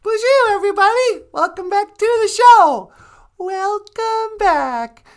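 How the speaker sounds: very high, loud Natasha greeting; high drawn-out Bonjour welcome as Natasha. Acted. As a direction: sing it out high and stretched like Natasha. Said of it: "the lovely and talented Natasha".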